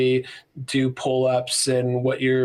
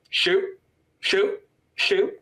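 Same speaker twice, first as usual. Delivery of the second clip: short, evenly spaced deadpan commands; mock stoic; 6 semitones higher, much slower than usual. Friend imagining the author holding a stoic look, deadpan imitation. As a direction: imitate a stoic, repeating the order unmoved. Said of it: "this very stoic look, just like".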